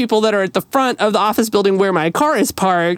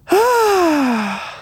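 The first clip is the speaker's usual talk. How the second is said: dreamy sigh; 9 semitones higher than usual. Real, not acted. Dreamy sigh before the story.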